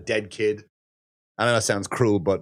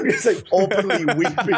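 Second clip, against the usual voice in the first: laughing through the words, higher and louder; 8 semitones higher, 4 dB louder than usual. Real, not acted. Laughing at himself openly weeping in a corner. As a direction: giggle it out.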